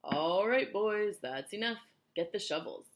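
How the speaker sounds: loud, drawn-out foreman's call; shouting. A narrator's reading. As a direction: call out to the whole work gang. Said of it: "they heard him shouting".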